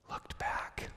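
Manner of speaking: hushed whispered reveal; whispered punchline; dramatic whisper. Acted. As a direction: let it drop to a breath. Dramatic reveal of Lot's wife.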